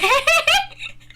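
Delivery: high-pitched, loud laugh; loud, high cackle of amusement; high laughing fit. Real, not acted. Laughs at the chat.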